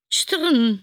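loud, high metallic clank sound; sharp clattering noise word; clattering rattle. Acted. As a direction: spit it out like a rattling gutter. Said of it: "csörrent megint valami".

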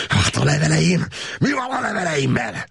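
high, hissing furious shout; hateful shout. A narrator's reading. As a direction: spit out the question in hatred. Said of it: "sziszegő gyűlölettel kiáltotta".

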